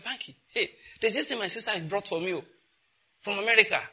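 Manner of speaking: excited friend voice. Retold or imitated.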